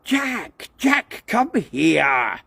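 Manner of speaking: high, slow calling shout. A narrator's reading. Peter shouting for Jack to come, high and slow. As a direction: call the cat across. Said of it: "shouted Peter".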